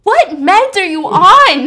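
loud, slow and incredulous; alarmed question. Retold or imitated. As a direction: demand it in alarm. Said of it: "Louise is like".

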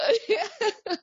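bright laugh breaking out; high laugh. Real, not acted. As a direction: let the laugh spill high. Laughing after mock quote.

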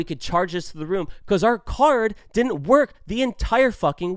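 loud, emphatic retold argument; heated yelling. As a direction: hammer each point in exasperation. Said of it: "sorry if that was a lot and if I was yelling".

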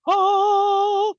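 high, loud sung-out "ah"; mock angel voice. Host guessing at how angels sound, mock angel voice. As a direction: hold one high note like a mock angel. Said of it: "it probably doesn't sound like".

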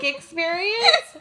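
high, slow, drawn-out disbelief; incredulous. Real, not acted. Host mocking friend's dog trauma, incredulous drawn-out question. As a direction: stretch the word in mock disbelief.

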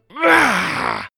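high yelp of pain; pained shout. A narrator's reading. Wonka's leg clamped by the door, pained shout. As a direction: cry out as if caught. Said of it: "he shouted as the chocolate door clamped down".

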